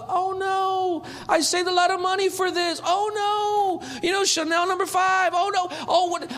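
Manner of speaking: hammed-up high fretful woman; fretting Mary. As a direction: whine high about losing the costly perfume. Mock Mary fretting over perfume.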